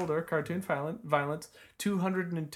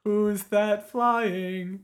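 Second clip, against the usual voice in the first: higher, slow, drawn-out announcer call; dramatic announcer; 5 semitones higher, much slower than usual. Acted. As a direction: stretch it out like a TV hype line.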